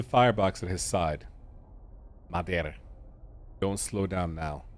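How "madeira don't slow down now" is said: understated Scottish voice giving an order; brief Scottish accent. Acted. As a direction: slip into a Scottish accent for a moment. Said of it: "I went Scottish for a second".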